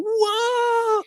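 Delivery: very high, stretched whoa; a squealing fanboy whoa; over-the-top whoa. Pretend. Host shows the reaction he avoided, squealing whoa. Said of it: "I'm not, like, going".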